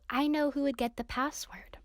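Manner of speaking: 声を出さずに息だけでささやく; 内緒話のように、ひそひそと; secret whisper. Acted. Leaning in to share a secret.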